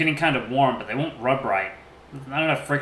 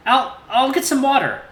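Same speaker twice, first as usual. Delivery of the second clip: gentle T J voice; 12 semitones higher, 7 dB louder than usual. A narrator's reading. T J asks about the oatmeal in his high voice. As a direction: voice the friendly lynx lightly.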